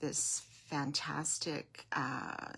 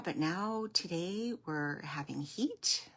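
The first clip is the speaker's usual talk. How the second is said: usual speaking voice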